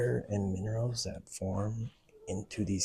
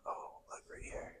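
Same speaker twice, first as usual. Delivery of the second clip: whispering; 13 dB quieter than usual. Real, not acted. Whispering in a cave.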